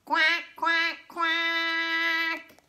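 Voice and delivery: quacking like a duck. Pretend. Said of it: "What does a duck say?".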